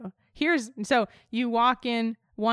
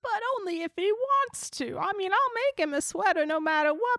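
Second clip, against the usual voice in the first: a hint of a sweet old grandma, a bit higher; lightly voiced grandma; 6 semitones higher than usual. Grandma offering Bigfoot a sweater, lightly voiced.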